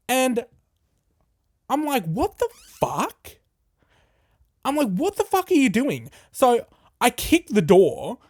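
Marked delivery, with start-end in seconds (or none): 2.0-3.4 shocked. Retold or imitated. Retold shock at peeping stranger. 4.9-6.1 raised, outraged; angry yell. Retold or imitated. Retold yelling at peeper.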